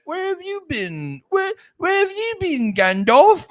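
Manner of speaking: high, plaintive British hobbit; Frodo. Acted. Hobbit asking the wizard where he was, mocking Frodo. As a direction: whine it high in a put-on British accent. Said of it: "Frodo's being like".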